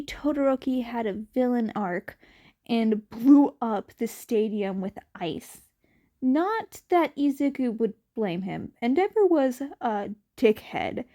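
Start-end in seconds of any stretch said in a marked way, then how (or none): none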